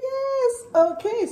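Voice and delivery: high, sing-song happy squeal; real giddy delight, squeaking with happiness; overjoyed squeal. Real, not acted. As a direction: let the joy send your voice way up. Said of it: "I'm so excited".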